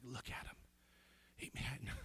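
awed whisper, slow and soft; proud dad whispering at the graduation. Retold or imitated. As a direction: breathe it out in proud wonder. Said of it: "I was like".

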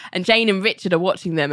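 ordinary talk

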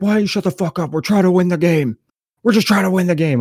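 high-pitched imitation of an irate player; whiny-high mock scolding; mock angry rant. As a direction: mimic a worked-up pitcher laying into you. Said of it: "pitcher just started laying into me".

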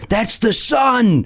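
high, drawn-out cry of recognition; mock fanboy amazement; awestruck comedy nerds. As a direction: imitate starstruck fans spotting someone. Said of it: "we were all, like, these comedy nerds of".

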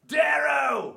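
high, loud goblin shout. Acted. Severo calling Darrow as asked, goblin-y shout. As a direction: holler it like a little goblin. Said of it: "Goblin-y, like you did the first time".

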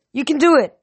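high, loud cheering-on; urgent encouragement. Acted. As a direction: shout to spur a falling friend on. Rider urging falling pegasus on.

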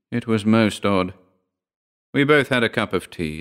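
plain reading voice

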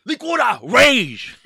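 put-on loud, high, drawn-out Japanese-accented shout; mock Japanese accent. Man announces Japanese drink in loud mock Japanese accent. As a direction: bellow it in a hammed-up Japanese accent. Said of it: "This is Japanese".

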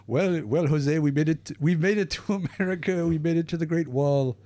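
a clumsy put-on Mexican accent. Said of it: "the worst Mexican accent I've ever heard".

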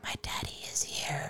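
put-on eerie whispered child's line; creepy whisper. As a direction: whisper it like a ghost is near. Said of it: "where she's like".

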